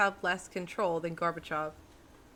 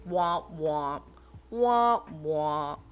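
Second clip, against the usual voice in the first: spoken sad-trombone womps; mock failure jingle; 2 semitones lower, 4 dB louder, much slower than usual. Streamer predicting the accent will flop, mock sad-trombone noise. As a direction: drop into a loud, mocking womp-womp.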